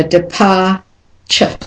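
"departure" slowed, ending split off as a weak chuh; slow model, depar... chuh; schwa ending demonstrated. Shown as a demonstration. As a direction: pause before the last syllable of "departure". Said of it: "is always said as a schwa".

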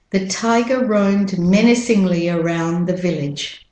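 British-Australian model sentence, "tiger" without final R; British-Australian accent, evenly spoken. Shown as a demonstration. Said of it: "And with the American accent".

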